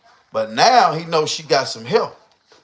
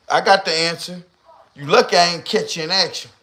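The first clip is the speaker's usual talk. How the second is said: ordinary talk, nothing marked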